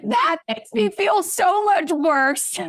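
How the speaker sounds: high, drawn-out protest; retold exasperation. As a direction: stretch it out in dismayed complaint. Retorting to mom's bombshell news.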